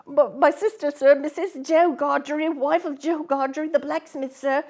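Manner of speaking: put-on boy explaining to convict; timid boy. A narrator's reading. Boy answers frightened.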